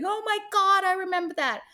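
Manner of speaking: high, gushing "oh my God"; gushing mock excitement. Teller imitating friends gushing over an old snap. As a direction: mimic friends squealing over memories.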